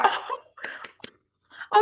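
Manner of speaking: very high, drawn-out retold squeal; overjoyed squeal. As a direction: squeal with delight at the news. Retells overjoyed reaction to the news.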